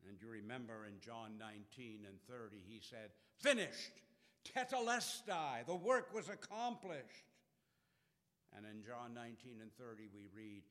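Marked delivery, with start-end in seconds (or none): 3.4-7.2 loud, high cry of triumph; triumphant cry. Acted. Preacher voicing Jesus' last cry, loud and triumphant. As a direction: cry it out like the final victory.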